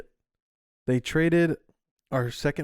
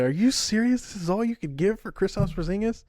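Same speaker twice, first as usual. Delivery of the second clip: higher-pitched mock outrage; indignant, incredulous imitation of angry fans; pissed fans; 7 semitones higher than usual. Host quoting Mavs Twitter after the trade, pissed and incredulous. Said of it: "They got pissed".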